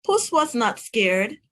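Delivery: usual speaking voice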